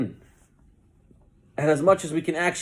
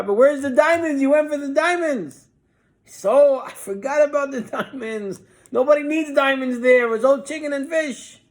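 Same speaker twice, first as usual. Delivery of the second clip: crying wife; 10 semitones higher, 5 dB louder than usual. Retold or imitated. Wife crying over lost diamonds.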